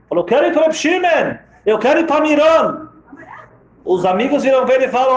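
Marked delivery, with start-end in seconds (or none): 0.2-2.9 high, pleading insistence; pleading with his friends to take him to Miron; desperate pleading. Acted. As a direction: beg to be taken, voice raised high. Patient begs to go to Miron.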